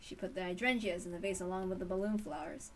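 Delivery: ordinary delivery, nothing marked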